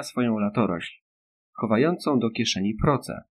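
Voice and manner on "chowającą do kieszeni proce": plain reading voice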